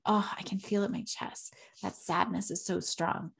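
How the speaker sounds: quietly naming sadness. Shown as a demonstration. Parent showing the kids how to name a feeling, softly. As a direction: murmur it as if feeling it in the chest. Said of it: "We're actually feeling them".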